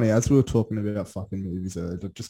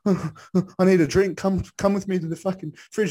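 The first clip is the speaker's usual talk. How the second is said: put-on shaken girlfriend; 9 semitones higher, 4 dB louder than usual. Scared girlfriend begging company to the fridge, shaky higher plea. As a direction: ask shakily to be walked to the fridge. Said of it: "And she's like".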